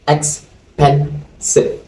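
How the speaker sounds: Expensive drawn out, each syllable separated slowly; slow syllable count. Shown as a demonstration. Teacher slowly spelling out Expensive syllable by syllable for students. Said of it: "Expensive has how many syllables?".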